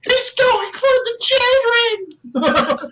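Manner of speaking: high, drawn-out shriek of alarm; hammed-up high villager scream; panicked villager. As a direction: wail it slowly at the top of the voice. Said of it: "You hear as you drop Dermot".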